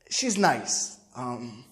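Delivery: very soft, halting, low admission; nervous. Retold or imitated. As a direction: hedge quietly with long hesitations. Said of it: "Then I start sound like we nervous".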